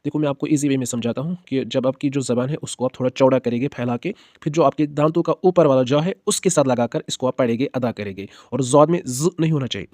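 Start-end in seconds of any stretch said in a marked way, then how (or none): none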